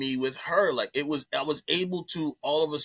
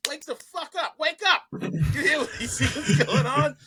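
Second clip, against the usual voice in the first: urgent wake-up yell; 12 semitones higher than usual. Retold or imitated. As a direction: shout him awake, half laughing. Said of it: "someone was like".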